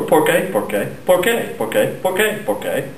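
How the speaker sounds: "por qué" over and over, pitch going up then dropping at the end; back-and-forth repetition. Shown as a demonstration. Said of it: "saying the same word over and over".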